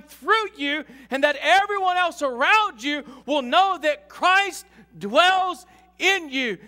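loud, high, slow preacher's shout; preaching at full force. Acted. Pastor proclaims to the church, full-force preaching. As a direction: drive every word home with a shout.

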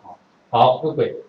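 ordinary talk, nothing marked

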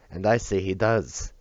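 slow, weighty angry declaration; roar. Acted. Drunk bodyguard overrules Celia, slow and angry. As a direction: plant each word, defying her. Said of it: "roared the man".

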